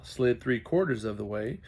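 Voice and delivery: ordinary delivery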